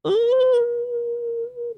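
high teasing ooh; a sly knowing ooh. Real, not acted. Ominous aside about couple.

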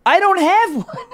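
high, loud, cracking with laughter; laughing punchline. Acted. Joking about a missing father, breaking into laughter. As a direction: laugh through the line.

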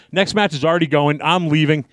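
ordinary talk, nothing marked

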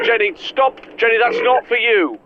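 high urgent call; alarmed calling out. Acted. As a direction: stop her, alarmed. Host yelling at Jenny.

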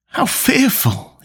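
slow, high-pitched horror; appalled, drawn-out murmur; shuddering mutter. A narrator's reading. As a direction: let the horror sink in slowly. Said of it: "he muttered, and a shudder ran through him".

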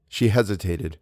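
ordinary delivery, nothing marked

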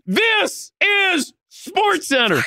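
very high and very slow, stretched-out yell of "This is SportsCenter"; yelled catchphrase. Retold or imitated. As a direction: belt it out slow and high like a broadcaster. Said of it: "uh, yells".

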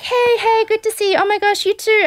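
high, bubbly mock greeting; gushing greeting. Couple greeting each other, high and gushing. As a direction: gush hello like old friends reuniting. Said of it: "they're like".

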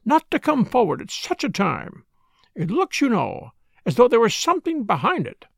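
high, fussy complaining; plaintive. A narrator's reading. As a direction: complain in a wounded, lilting tone. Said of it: "in a plaintive voice".

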